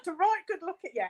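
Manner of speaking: fast, loud and laughing. Real, not acted. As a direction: bubble the words out through a laugh. Echoes host, laughing.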